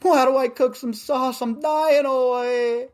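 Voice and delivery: mock helpless plea; whiny kid to mom. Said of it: "I'm like".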